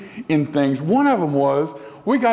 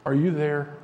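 put-on a child's quiet question in the dark; asked quietly; 5 dB quieter than usual. A narrator's reading. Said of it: "the little girl asked quietly".

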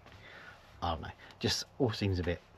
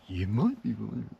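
mock presenter, higher and louder; weird presenter; 4 semitones higher, 5 dB louder than usual. Vlogger repeating the neighbour's intro, mock presenter voice. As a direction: send up a presenter's opening line. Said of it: "he has this sort of weird presenter tone".

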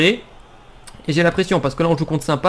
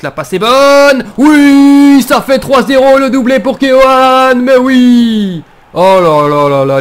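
loud, higher, slow triumphant cry; excited sports commentary; 7 semitones higher, 9 dB louder, slower than usual. Real, not acted. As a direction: shout the goal out slowly. Third goal celebration.

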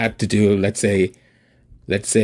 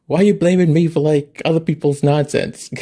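indignant protest; 7 semitones higher, 5 dB louder than usual. Retold or imitated. Retelling argument with mom.

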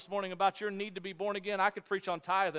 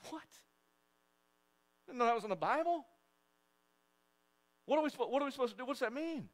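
mock confused; 7 semitones higher, 4 dB quieter than usual. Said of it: "Some of y'all are going".

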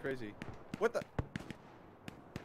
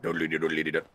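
machine gun noise; 11 semitones lower, faster than usual. Pretend. Imitating machine gun fire.

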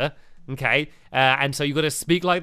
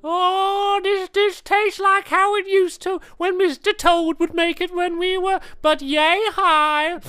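raised-pitch playful lizard voice; gecko character voice; 13 semitones higher, 4 dB louder than usual. Acted. Gecko greeting his old friend Betsy, raised playful character voice. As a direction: play the fussy old gecko catching up.

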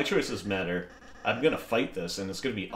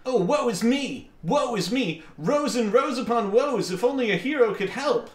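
high, loud theatrical lament; Lancer; 12 semitones higher, 5 dB louder than usual. Acted. Player voicing a game character's woe, hammed-up high wailing. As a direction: wail melodramatically in a high cartoon voice. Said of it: "I'm just doing the voice for Lancer".

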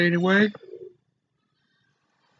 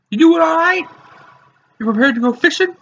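silly voice; 11 semitones higher, 7 dB louder than usual. Acted. Greets viewers in silly voice.

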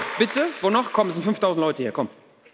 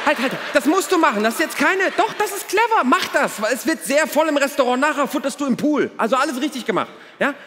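urgent approval; 7 semitones higher than usual. Acted. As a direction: cut in and cheer them on eagerly. Acted urgent advice to tourist couple.

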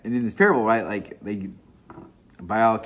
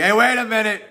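much higher, louder hey wait a minute; mock indignant objection; 13 semitones higher, 10 dB louder, faster than usual. Greeks objecting to the apostles' parable, mock indignant high voice. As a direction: play the offended Greeks catching on. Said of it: "'Cause the Greeks are like".

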